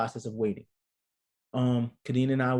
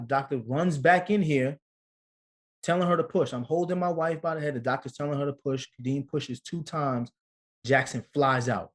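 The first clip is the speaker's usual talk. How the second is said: normal talking voice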